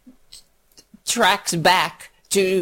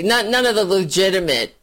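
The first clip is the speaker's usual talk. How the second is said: ordinary delivery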